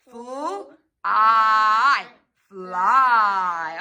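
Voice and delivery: "Fl-" then a long "I", then "Fly"; sounding out. Shown as a demonstration. Sounding out fly for the child, split then blended. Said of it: "Let's sound out the word fly".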